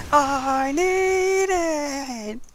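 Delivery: high, dragged-out plea; desperate I need it. Pretend. Gamer caving after refusing, high drawn-out cry. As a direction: stretch it out in sudden desperation. Comic reversal after denial.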